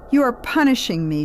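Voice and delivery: high, loud accusation; distressed outburst at mother; crying out. A narrator's reading. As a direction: cry it out, wounded. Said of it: "she cried".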